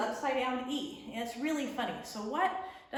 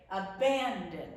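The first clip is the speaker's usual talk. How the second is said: abandon with BAN a little stronger, falling at the end; stress on middle syllable; 2 semitones lower, slower than usual. Shown as a demonstration. Teacher showing the correct accent, middle syllable of abandon stressed. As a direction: lean gently on BAN in abandon. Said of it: "We say the middle syllable just a little bit louder".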